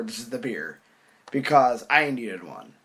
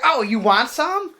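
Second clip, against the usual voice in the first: high, slow singsong offer; mock an overeager girl, pitched up; mimicked annoying girl; 9 semitones higher, slower than usual. Girl offering her virgin daiquiri, high mimicking voice.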